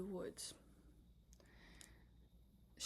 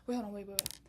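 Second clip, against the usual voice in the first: ordinary delivery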